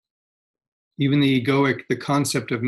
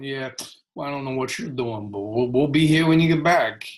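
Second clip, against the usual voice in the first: lightly put-on, unbothered character voice; waiting happiness voice. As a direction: voice the patient ones left behind, lightly acted. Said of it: "Guys back there going".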